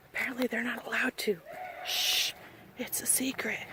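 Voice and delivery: hushed, conspiratorial secret whisper; playful whisper. Real, not acted. Playful secret aside to viewers.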